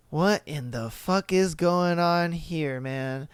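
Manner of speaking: low, hushed aside to oneself; muttered disbelief. Acted. Bartender watching a drunk kid on the balcony, muttered disbelief. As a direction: say it softly, baffled, fading out at the end.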